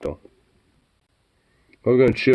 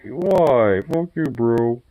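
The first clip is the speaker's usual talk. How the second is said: mock-indignant dog voice, pitched up; higher, put-out pet voice; voicing upset dog; 5 semitones higher, much slower than usual. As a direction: play the pouting dog's protest, drawn out at the end. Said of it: "He's just like".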